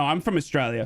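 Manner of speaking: normal talking voice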